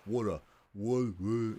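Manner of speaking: water pushed from the throat in an Australian accent, cut off; straining to say water the Australian way; forced Australian accent. Shown as a demonstration. Forced, throaty Australian water. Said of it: "you can kinda hear it is in my throat".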